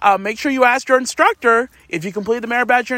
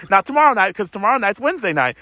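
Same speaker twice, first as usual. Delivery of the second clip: usual speaking voice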